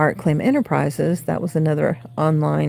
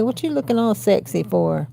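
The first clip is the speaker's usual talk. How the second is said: teasing cat; 9 semitones higher than usual. Real, not acted.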